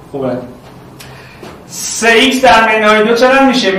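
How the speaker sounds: ordinary talk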